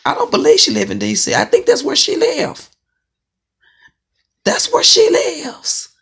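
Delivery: high, loud, fast incredulous squeal; high disbelief. Real, not acted. Ranting to viewers about a rival, high incredulous squeal. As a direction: squeal it like you can't believe it.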